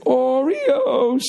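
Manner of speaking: very high, long-drawn cry; echoing singsong call. Retold or imitated. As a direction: imitate a call ringing round a high ceiling. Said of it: "echoed when he did it".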